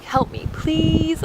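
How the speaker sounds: high, drawn-out plea for help; retold pleading. Traveler asking a stranger who knows English for help, pleading.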